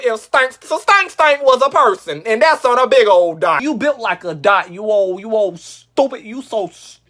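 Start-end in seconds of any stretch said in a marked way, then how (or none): none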